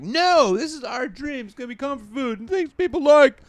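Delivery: high, loud, exasperated sitcom rant; Jerry Seinfeld. Acted. Caleb's critics defend the restaurant dream, high Seinfeld-like rant. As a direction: push it way up high and loud, incredulous. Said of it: "Oh, Jerry Seinfeld showed up".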